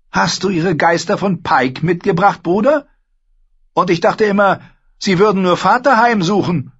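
Asha, cool and scornful. Acted. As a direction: talk down to him, sharp and sure. Asha teases.

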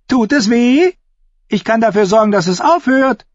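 high, loud, sneering jester voice; mocking mad fool. A narrator's reading. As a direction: taunt the crippled smuggler gleefully. Shackwell taunts the injured man. (höhnischer Narr, hoch und laut)

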